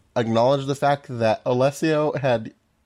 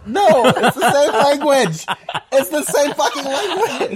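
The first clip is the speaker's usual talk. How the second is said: high, loud words through laughter; laughing it out in disbelief; 14 semitones higher, 6 dB louder than usual. Real, not acted. To a co-host's dub question, laughing loudly.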